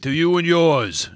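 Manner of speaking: slow, rather gravel-free Mafia toast; mock Godfather toast. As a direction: raise a glass like a mob boss. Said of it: "Have my Godfather".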